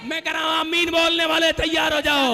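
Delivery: loud, rising prophetic declaration; preaching at full force. Acted. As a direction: build the prophecy to a shout. (पूरे ज़ोर से प्रचार करते हुए)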